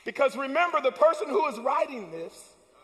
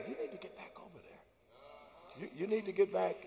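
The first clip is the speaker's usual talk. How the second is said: much softer, lower inner voice; 5 semitones lower, 11 dB quieter than usual. Retold or imitated. Goodness and mercy voiced as a soft inner conscience. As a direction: murmur it like a conscience. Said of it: "they bring the words that say".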